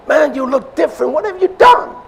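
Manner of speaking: high excited "Man, you look different"; lightly voiced street greeting, rising to a high squeal; old friends greeting. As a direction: play an amazed old buddy. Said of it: "that's the way they said it in my days".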